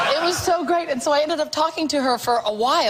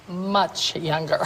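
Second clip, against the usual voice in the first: slow knowing quote; 5 semitones lower, 4 dB quieter, much slower than usual. Retold or imitated. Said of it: "And she goes".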